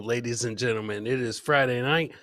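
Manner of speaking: ordinary talk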